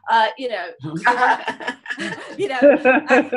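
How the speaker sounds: laughing it off after a swear word; a real, sheepish laugh breaking through; loud laugh at herself; embarrassed laugh. Real, not acted.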